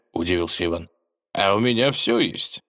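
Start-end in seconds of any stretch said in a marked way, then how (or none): 1.3-2.6 boastful. Acted. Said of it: "похвастался загадочный персонаж".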